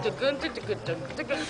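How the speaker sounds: soft rhythmic mock clunks; clunking steps. Imitating the helper walking over on braces, soft clunks. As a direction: tap out the sound of leg braces walking. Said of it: "and he's like".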